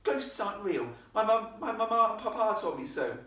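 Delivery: put-on high, loud insistent child; well-bred girl's firm objection; posh girl.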